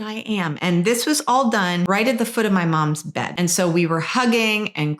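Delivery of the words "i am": quiet reply. Retold or imitated. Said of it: "And he said".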